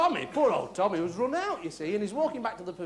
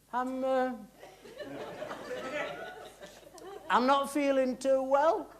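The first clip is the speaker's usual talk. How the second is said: slow, higher-pitched ailing man; 4 semitones higher, slower than usual. Acted. Tommy tells his friend he's not feeling well, ailing and slow. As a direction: draw it out slowly as someone unwell. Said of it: "he went like this".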